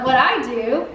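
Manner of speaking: usual speaking voice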